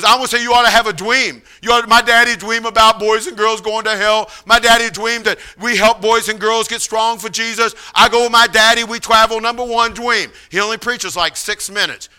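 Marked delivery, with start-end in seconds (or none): 0.0-10.3 higher, childlike imitation with w for l and r; pitched-up, level, heartfelt declarations; Paul preaching. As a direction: imitate an earnest, simple young man testifying. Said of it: "And so he goes".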